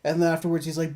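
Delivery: ordinary talk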